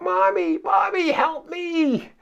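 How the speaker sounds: high, panicked cry for mommy; screaming for help. Pretend. Frightened disciple screaming for mommy as boat sinks. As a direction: shriek for mommy in the storm. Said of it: "Maybe you would have screamed for help".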